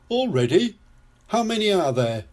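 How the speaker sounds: high, incredulous mild grumble; grumbling. A narrator's reading. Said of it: "grumbles Daddy Pig".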